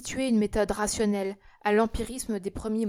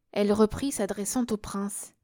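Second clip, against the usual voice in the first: plain reading voice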